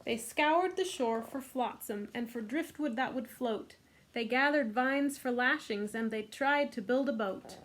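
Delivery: normal talking voice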